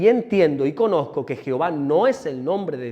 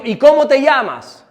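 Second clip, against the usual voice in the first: Moses asking; 7 semitones higher, 9 dB louder than usual. Retold or imitated. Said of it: "Moisés le dijo".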